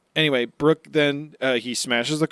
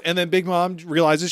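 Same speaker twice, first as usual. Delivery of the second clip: ordinary talk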